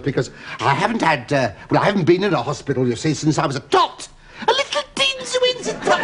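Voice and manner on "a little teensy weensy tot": put-on very high squeaky baby voice. Comic patient squeaks about being a tiny tot, very high. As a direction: squeak it out like a toddler.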